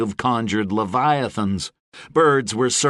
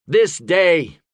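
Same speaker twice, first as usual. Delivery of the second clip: higher, louder proclamation; rousing oratory; 8 semitones higher, 5 dB louder than usual. A narrator's reading. Gabriel to the bands before battle, proclaiming. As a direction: proclaim it to the army.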